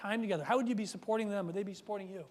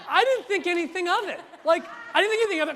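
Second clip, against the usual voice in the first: laughing through the words, a bit louder and higher; 7 semitones higher, 8 dB louder, faster than usual. Real, not acted. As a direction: chuckle as you tell it. Laughing at his own story.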